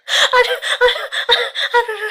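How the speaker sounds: high squealing laugh and groans; shrieking giggles. Real, not acted. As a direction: laugh till it hurts. Laughing helplessly at own joke.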